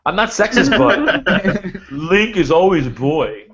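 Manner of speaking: high, slow and laughing; sarcastic mock excuse. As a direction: drag it out through a laugh. Mocking a sexist excuse.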